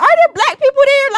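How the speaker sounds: high, loud incredulous asking; astonished question. Retold or imitated. Retells astonished question to school peers.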